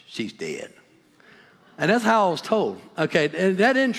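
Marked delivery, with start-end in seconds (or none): 0.1-0.7 very low, soft gruff voice delivering bad news; gruff uncle. Uncle breaks the news of his mother, low gruff voice. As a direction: sink deep and quiet, blunt and gruff. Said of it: "He had his gruff voice".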